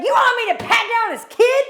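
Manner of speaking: high-pitched mock yell at the mom; retold yelling. Airport agent yelling at the mom over the pat-down, high mock yell. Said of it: "like, yelling".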